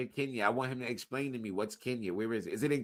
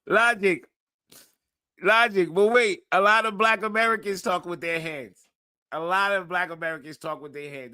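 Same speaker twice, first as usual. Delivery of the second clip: excited shouting; 8 semitones higher, 11 dB louder than usual. Real, not acted. Loud excited rant.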